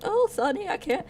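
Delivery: put-on high old granny voice asking for help; grandma voice. Granny asking the boy to lift her suitcase, high and frail. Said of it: "grandma has a suitcase, and it's like".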